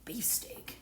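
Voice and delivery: Beefsteak whispered under the breath. Shown as a demonstration. Repeating the tomato name in a whisper. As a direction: breathe the word Beefsteak softly.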